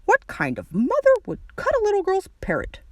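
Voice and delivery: put-on indignant old man character voice; indignant voice leaping up in pitch; disapproving grandpa. A narrator's reading. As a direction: scold like an outraged grandpa. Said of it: "said the grandpa".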